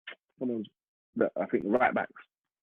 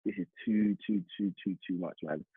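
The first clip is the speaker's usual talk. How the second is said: overwhelmed murmur; 4 semitones lower, 6 dB quieter than usual. Retold or imitated. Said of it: "I was like".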